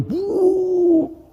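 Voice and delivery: high drawn-out imitated blast; whoosh sound. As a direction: make the sound of sudden chaos. Bunyi kiamat terjadi. (tiruan bunyi dentuman panjang)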